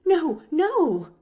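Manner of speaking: high, loud protest; alarmed. A narrator's reading. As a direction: blurt it out to stop the child. Nancy alarmed at Pollyanna telling aunt.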